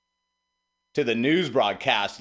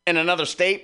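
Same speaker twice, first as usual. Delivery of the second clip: usual speaking voice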